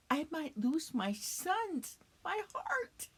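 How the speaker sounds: high, hushed and slow, fading into a whisper; anguished dread. Acted. Widow fearing she will lose her sons, anguished dread. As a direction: let the voice rise and thin to a whisper.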